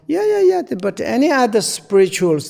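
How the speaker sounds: high, lightly mocking yeah yeah yeah; mock dismissive voice. Teacher mimicking people who dismiss other traditions, mock high voice. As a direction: brush it off in a squeaky mock voice. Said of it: "they talking about the compassions".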